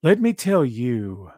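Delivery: slow, measured cadence with breaks mid-phrase; Keith Lee. Retold or imitated. Showing a viewer how the wrestler talks, slow deliberate cadence. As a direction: draw each phrase out deliberately. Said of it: "It's just his cadence".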